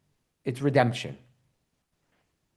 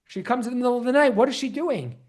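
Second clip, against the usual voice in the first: ordinary talk, nothing marked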